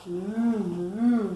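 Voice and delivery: drawn-out humming moo; cow's moo. A narrator's reading. Reader voices the cow for a child, mooing. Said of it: "said the cow".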